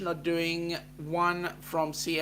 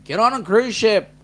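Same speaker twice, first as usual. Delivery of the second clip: excited call; 7 semitones higher, 11 dB louder than usual. Real, not acted. Excited pitch to viewers.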